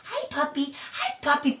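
put-on high, soft baby talk to a dog; talking to a puppy. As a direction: coo at the puppy. Imagining greeting the sick puppy.

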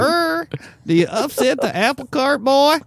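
put-on high old-timer scolding boy; old man voice. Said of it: "That was a good old man voice".

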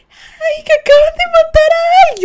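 high, loud alarmed cry; alarmed coworker. Retold or imitated. Coworker at the window crying out that someone was killed, high alarmed shout. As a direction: announce the killing in panic. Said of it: "mi compañera que estaba mirando".

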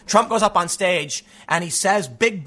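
ordinary talk, nothing marked

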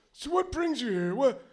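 lightly voiced inquisitive old man; curious old wizard. Wizard asks purpose.

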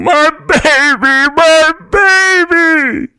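very high, loud, slow crying screams; screaming in anguish. A narrator's reading. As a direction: wail for the baby in panic. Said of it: "was still screaming".

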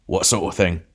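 a mild gruff growl; beefy man's lightly voiced challenge. A narrator's reading. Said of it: "growled the other".

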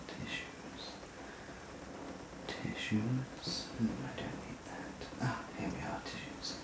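distant whisper from across the room; far off. Acted. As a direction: move away from the mic while searching. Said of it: "I'm just going to wander off over here".

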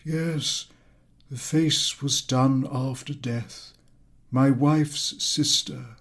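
hushed, mournful reply about a dead relative; sorrowful. Acted. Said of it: "sorrowfully replied".

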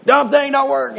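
mock yell of a relative at a tool that won't work; mock angry yell. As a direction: imitate an uncle cursing a broken tool. Imitating angry relatives yelling at tools.

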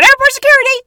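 high, loud imitation of the woman; mock yell. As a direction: yell it like a frantic lady roaming the airport. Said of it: "She's just walking around going".

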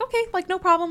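high, bright mock-casual okay; breezy okay. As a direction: brush it off like no big deal. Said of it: "And I was like".

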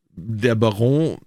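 put-on slow, drawn-out French-accented words; French-accented old gentleman, very slow; light French accent. A narrator's reading. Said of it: "sagte mit leicht französischem Akzent".

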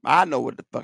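ordinary talk, nothing marked

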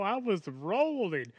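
ordinary talk, nothing marked

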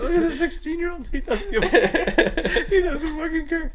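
high, laughing mock of the servers; quoting coworkers while cracking up. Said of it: "They're like".

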